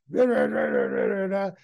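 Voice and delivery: mock furious grunts standing in for swear words; wordless angry yelling. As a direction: growl out nonsense curses at the boss. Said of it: "yells out some explicit".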